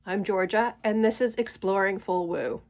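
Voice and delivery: normal talking voice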